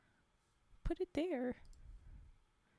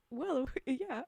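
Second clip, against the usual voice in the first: usual speaking voice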